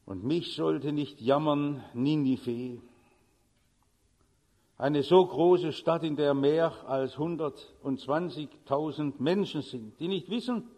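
low, measured Bible reading; solemn scripture reading. Acted. Reads Bible passage solemnly.

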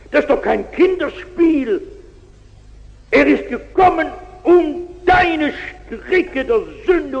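put-on rousing plea to the drunkard; fervent appeal. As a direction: preach it straight into his face. Friend preaches to the drunkard.

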